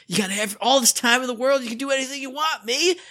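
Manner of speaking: high-pitched giddy pep talk to yourself; giddy self-pep. Retold or imitated. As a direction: cheer yourself on in a squeaky excited voice. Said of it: "Oh, just check in".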